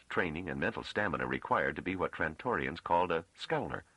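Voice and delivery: plain reading voice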